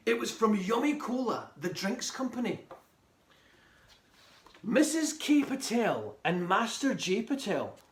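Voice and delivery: lively read-aloud storytelling; story narration. Acted. Author reading chapter one to young listeners, lively storytelling. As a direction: read it to a room of children, animated.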